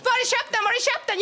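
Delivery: high, excited repeated question; imitated eager friends. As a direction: pester in a mock-thrilled squeal. Voices envious friends asking.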